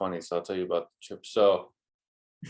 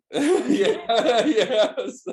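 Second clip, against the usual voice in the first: high, loud laughing yes; 13 semitones higher, 7 dB louder than usual. Retold or imitated. Answering the van driver with a high laughing yes.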